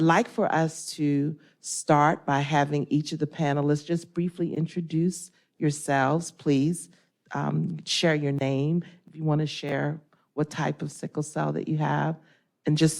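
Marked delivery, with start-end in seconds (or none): none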